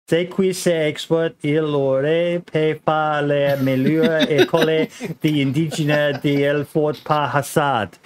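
slow, clumsy French with a Mexican lilt; French read in a Mexican accent. Acted. Host reading a French chat comment aloud, in a Mexican-tinged accent. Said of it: "Somehow put on a Mexican accent while reading French".

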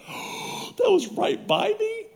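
high, slow, stretched-out shock; shocked disbelief. Retold or imitated. Preacher retelling to the congregation, slow high shocked question. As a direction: act amazed the bats were that close.

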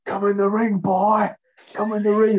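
high, loud taunt calling him in; taunting shout. Retold or imitated. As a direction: yell the challenge across the ring. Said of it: "And he just went".

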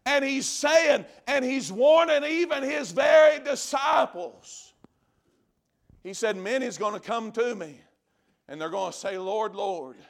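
ordinary talk, nothing marked

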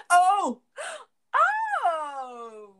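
loud, high, drawn-out exclamation; dawning realization. Real, not acted. Just getting why his name is Ransom, loudly amazed. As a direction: let the penny drop loudly, stretching it out.

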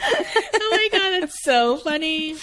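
high, slow laugh-through words; laughing hard while talking; real giggling delight. Real, not acted. Laughing at the movie quote.

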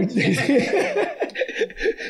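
high-pitched burst of laughter. Real, not acted. Host cracking up at the amateurs' whining, high laugh. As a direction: let out a squealing laugh.

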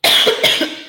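real coughing fit from choking. Real, not acted. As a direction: cough hard after swallowing wrong. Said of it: "engasguei aqui".

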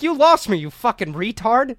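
high, loud mock outrage; outraged retort. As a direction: act out a kid yelling back at a parent. Acted quarrel with mother.

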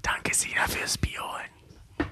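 slow breathy whisper of thanks. Real, not acted.

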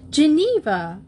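high, loud accusing call; accusing cry. A narrator's reading. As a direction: call her name in reproach. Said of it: "Marcia cried accusingly".

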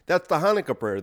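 ordinary talk, nothing marked

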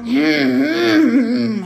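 loud, high closed-mouth whine; long wordless ventriloquist hum; muffled humming attempt. A narrator's reading. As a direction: try to speak with lips sealed. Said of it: "aber es kam nur".

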